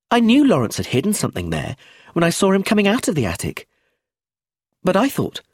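put-on high-pitched little-girl voice; knowing child. A narrator's reading. Child detective tells of the attic, knowing. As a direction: pipe it up high, showing off what you know.

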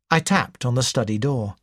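ordinary delivery

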